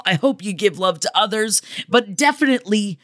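ordinary talk

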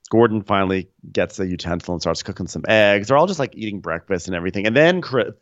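ordinary delivery

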